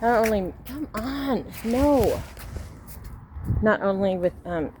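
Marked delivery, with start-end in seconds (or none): 0.0-2.2 higher, sudden no to the dog; scolding the dog. Real, not acted. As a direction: stop the dog mid-sentence.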